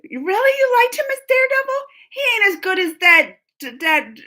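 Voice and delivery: loud, high-pitched disbelief; incredulous. Real, not acted. Streamer disputing a viewer's pick of Daredevil, incredulous. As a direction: challenge it in real astonishment, voice shooting up.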